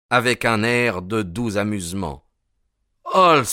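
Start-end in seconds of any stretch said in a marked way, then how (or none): 3.1-3.4 high, loud cry of surprise; startled outburst; astonished exclamation. A narrator's reading. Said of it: "m'écriai-je".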